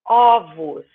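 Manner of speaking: ovos with open first O; ovos, opening the first O wide; open O plural. Shown as a demonstration. Teacher shows the right plural of ovo. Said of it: "de um som fechado ... um som aberto".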